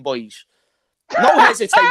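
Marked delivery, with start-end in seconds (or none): 1.2-1.9 high, loud hyped cry; excited shout. Real, not acted. Hyping the defender's answer to his mates, shouting excitedly. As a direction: belt it out in delight.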